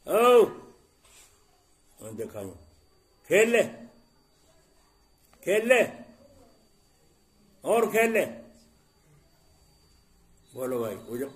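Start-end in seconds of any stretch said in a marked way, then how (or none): 0.0-0.4 sudden high, loud furious "او!"; angry teacher. Retold or imitated. Teacher losing patience with a fidgeting pupil, loud angry shout. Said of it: "تو استاد نے غصے میں کیا کہا". 3.3-8.2 high, loud sarcastic "کھیل لے" thrown out again and again; mock permission, really a threat; angry sarcastic teacher. Teacher to a pupil who keeps playing, loud sarcastic anger. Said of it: "تو استاد نے غصے میں".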